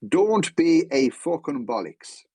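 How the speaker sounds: put-on slow, emphatic Dublin accent. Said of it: "in his very Dublin accent".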